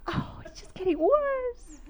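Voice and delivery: high, drawn-out comic wail; mock dismay. Wife seeing it get worse, comic wail of dismay. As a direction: let it out slowly as a high moan. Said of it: "I'm like".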